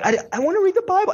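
high, fast childish whine, lightly voiced; whiny little girl. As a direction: mimic a three-year-old begging to read. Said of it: "And she'll start crying".

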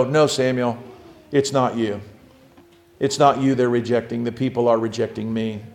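low, soft voice of God consoling; gentle reassurance. Acted. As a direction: quietly reassure someone who feels to blame. God reassuring a discouraged Samuel.

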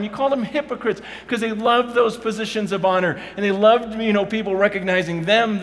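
loud, high, impassioned preaching; fired-up sermon voice; preaching at full force. Acted. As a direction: pour it out to the congregation.

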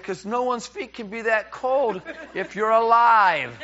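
high, drawn-out comic exclamation; incredulous punchline, stretched and high; mock incredulity. Retold bedtime shock at cold feet.

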